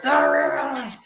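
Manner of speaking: strained high rasp on The Raiders; mock choked croak; choking smoker voice. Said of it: "sounds like he's choking on a cigarette".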